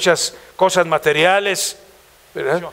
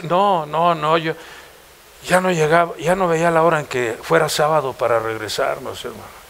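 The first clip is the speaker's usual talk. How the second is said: ordinary talk, nothing marked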